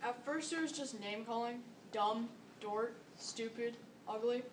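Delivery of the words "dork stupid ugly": usual speaking voice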